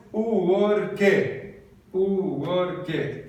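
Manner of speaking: thick Brazilian accent, three syllables in "Work"; Brazilian-accented English. Shown as a demonstration. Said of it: "um bom brasileiro diria".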